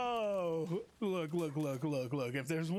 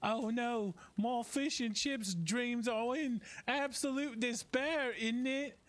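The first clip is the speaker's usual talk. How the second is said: slow, higher mock British accent; lightly put-on British voice, teasing; British impression; 6 semitones higher, slower than usual. Teasing British viewers in a mock British accent. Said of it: "my British impression".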